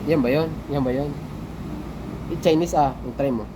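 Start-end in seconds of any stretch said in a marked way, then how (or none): none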